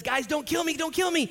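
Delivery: high, loud, panicky pleading; mock frightened plea. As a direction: squeal it like a scared little man begging. Paul voiced as frightened.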